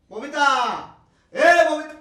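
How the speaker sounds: loud, high yell for his wife; exasperated shouted summons; shouting. Acted. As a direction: bellow the name, losing patience. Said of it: "এত চিৎকার করছো কেন".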